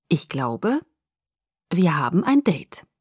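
pointed, measured delivery with a pause; meaningful. Acted. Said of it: "sagte er bedeutungsvoll".

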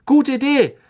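high, loud, gleeful question; delighted at the chance to party on; beaming. A narrator's reading. Fred cheering on the party, beaming and loud. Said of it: "fragte Fred Weasley strahlend".

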